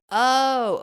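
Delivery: quick, high, loud "Oh" of noticing; sudden oh of realization. Retold or imitated. To herself, noticing her pattern, a bright surprised oh. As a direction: catch yourself in the act.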